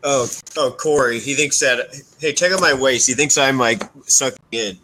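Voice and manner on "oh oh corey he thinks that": normal talking voice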